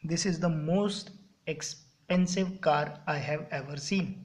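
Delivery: very slow, word by word for copying; spaced-out dictation pace. Shown as a demonstration. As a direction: dictate it so the class can write. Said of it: "मतलब अब comparison ज़्यादा के साथ".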